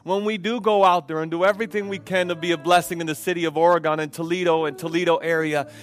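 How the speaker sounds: building from earnest prayer to loud, high fervent cries; impassioned preaching prayer. Real, not acted. Pastor leads the church in prayer, building to loud fervent pleading. Closing prayer rising to full force.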